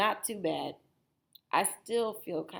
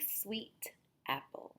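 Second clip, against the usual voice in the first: ordinary delivery, nothing marked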